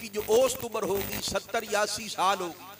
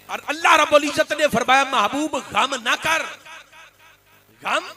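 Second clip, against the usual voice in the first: louder, higher divine promise, slowed in places; impassioned preaching; 5 semitones higher, 7 dB louder than usual. Acted. Preacher voicing God's promise to the Prophet, impassioned. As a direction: proclaim it to the crowd.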